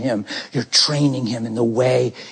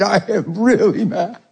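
a hint of an earnest little boy, pitched higher; eight-year-old son; 5 semitones higher than usual. Son admitting anger to Daddy, lightly voiced childlike insistence. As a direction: imitate a child insisting on his feelings. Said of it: "He was eight years old".

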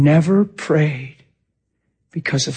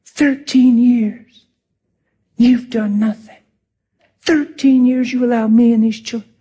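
retold rant; 6 semitones higher than usual. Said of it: "'cause of that rant".

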